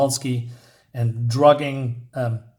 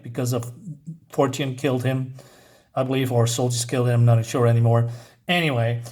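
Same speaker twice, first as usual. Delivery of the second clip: ordinary talk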